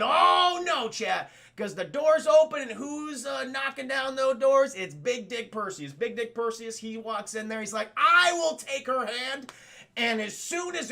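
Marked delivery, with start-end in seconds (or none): 0.0-4.7 loud, slow, higher storyteller's build; dramatic buildup. A narrator's reading. Streamer hypes Perseus's entrance, loud and slow. Perseus bursts through the doors. 8.0-9.5 slow, louder, higher, grand hero; heroic declaration. Retold or imitated. Perseus claims Andromeda's hand, slow and grand.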